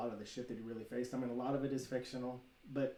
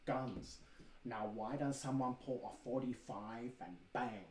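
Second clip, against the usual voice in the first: Bruce Lee impression; 5 dB quieter, slower than usual. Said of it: "I can do a wicked Bruce Lee impersonation".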